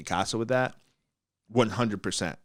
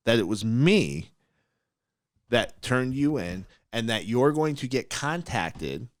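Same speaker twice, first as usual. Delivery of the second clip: slow warning; slower than usual. Retold or imitated. Coldly warning her on phone.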